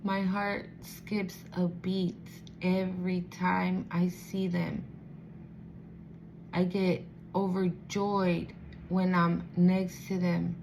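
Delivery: slow, dreamy adoration, lingering on each word; lovestruck. Acted. This person secretly adoring the one they love, lovestruck. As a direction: savour each phrase with long pauses, as a secret. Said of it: "this person just looks like".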